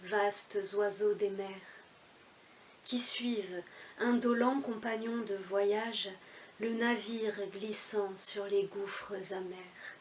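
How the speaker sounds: poem recitation. Acted. Reciting Baudelaire's L'Albatros, as a measured poem recitation. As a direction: declaim the verse line by line, each phrase set apart.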